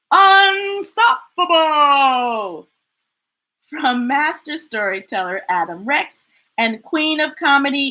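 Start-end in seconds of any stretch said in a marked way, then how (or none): 0.1-2.7 slow, drawn-out announcer voice; dramatic title. Acted. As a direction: stretch the title out big and slow.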